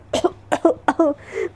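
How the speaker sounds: a sudden choking cough; coughing fit. Acted. Friend coughs in shock at the flirty line, sudden coughing fit. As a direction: cough hard as if caught off guard. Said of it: "Radhika एकदम खांसने लगती है".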